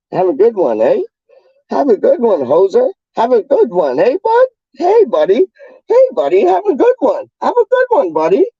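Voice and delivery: very high, squeaky Canadian caricature; mock Canadian. Streamer mocking how locals say goodbye, exaggerated high Canadian voice. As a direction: put on a silly high-pitched Canadian greeting. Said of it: "That's how they talk down here".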